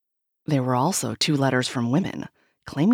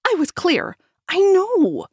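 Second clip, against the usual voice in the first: interrupting; 6 dB louder than usual. A narrator's reading. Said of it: "he interrupted".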